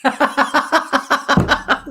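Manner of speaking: loud high burst of real laughter. Real, not acted. Medium cracking up at a viewer's comment, loud high laugh.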